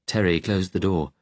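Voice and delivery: ordinary delivery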